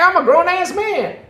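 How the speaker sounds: high, loud indignant yell; hammed-up grown man protest; mock Kevin Hart shout. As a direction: shout it like an offended short guy.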